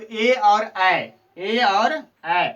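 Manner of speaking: ए और ऐ को खींचकर ज़ोर से बोलना; drawn-out vowel demo. Shown as a demonstration. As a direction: draw out ए और ऐ so the students hear both. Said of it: "ए दैतो कंठ तालु".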